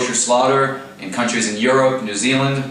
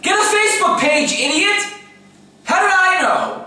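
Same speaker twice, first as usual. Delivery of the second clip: exasperated yell. Real, not acted. Yelling at Barak.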